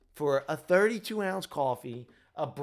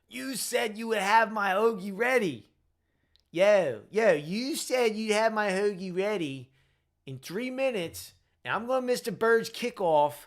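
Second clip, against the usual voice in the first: hammed-up Delco accent, higher and louder, ranting; 7 semitones higher, 6 dB louder than usual. Disgruntled Eagles fan yelling at the Wawa cashier, loud Delco accent rant. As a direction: rant at the counter in a thick, badly done Delco accent. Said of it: "the worst Delco accent I've ever done".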